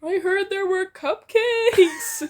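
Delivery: high, slow, loud yell into the kitchen. A narrator's reading. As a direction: call out with a laugh in the voice. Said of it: "Denki yells".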